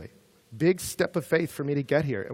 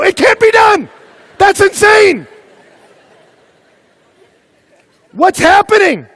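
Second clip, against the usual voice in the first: high, full-force yell of disbelief; astonished shouting; 19 dB louder than usual. Acted. Crowd reacting to an impossible stunt, shouting in amazement. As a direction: scream it like a stunned spectator.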